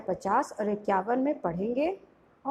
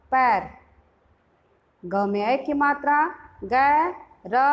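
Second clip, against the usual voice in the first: slow, loud, syllable by syllable; teacher's phonics chant; spelling drill; 2 semitones higher, 4 dB louder, slower than usual. Shown as a demonstration. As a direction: build each word aloud for the class.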